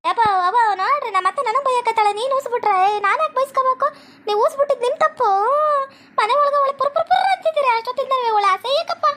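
high, whining daughter-in-law voice; shrill-edged tattling to the elders; whiny complaint. Acted. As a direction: complain up high like a wronged bride.

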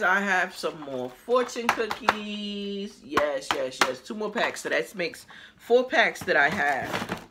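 ordinary talk, nothing marked

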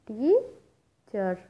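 Teacher slowly split in two, टी stretched and rising, then चर; syllable by syllable. Shown as a demonstration. Teacher counts syllables for students, teacher split slowly in two. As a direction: break teacher into टी, pause, चर. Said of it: "number of syllables है टू".

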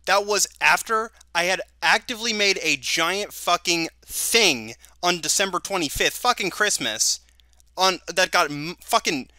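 real anger, dragging out the stressed words; really pissed. Real, not acted. Telling the group about the admin offer, really pissed. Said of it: "I'm actually really pissed".

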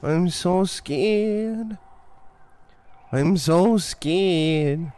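hammed-up wailing, vowels stretched long; badly faked spooked moaning; mock fear. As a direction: fake a fright, slowly and sarcastically. Mocking the horror game's scares.

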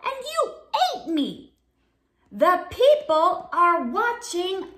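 high, loud, outraged accusation; indignant Bob. Acted. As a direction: scold the shark in front of viewers. Bob accuses the shark on air.

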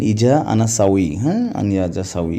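ordinary talk, nothing marked

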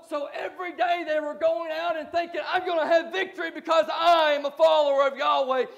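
high, loud preaching, shouting at peaks; impassioned preaching. Acted. Pastor warning the congregation, loud and high. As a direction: drive the warning home to the church.

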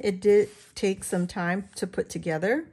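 normal talking voice

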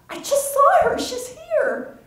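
very high breathless rush of words; mother sure she saw her daughter; frantic insistence. Acted. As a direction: insist wildly she is here. Marcy insists she saw Devon.

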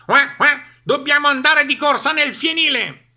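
high, loud quacking duck; squawking duck. A narrator's reading. (Papera che schiamazza)

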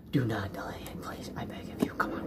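soft unvoiced whisper, begging; whispered plea. Real, not acted. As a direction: breathe the plea in a hush.

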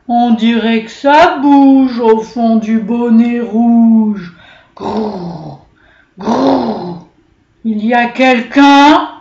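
slow, loud, growly bear voice; a suspicious bear grumbling into the hat; growling bear. A narrator's reading. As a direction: bear growling at whoever is inside the cap. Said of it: "il se mit à grogner".

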